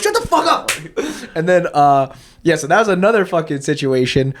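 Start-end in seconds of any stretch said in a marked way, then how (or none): 0.0-0.7 high yelled shut up; imitated yell. Retelling the slap, a high mock yell. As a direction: yell it as you slap him. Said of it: "and I'm like".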